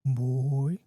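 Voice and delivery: low, drawn-out wolf voice; deep lingering creature word; low growl. A narrator's reading. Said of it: "in that same low growl".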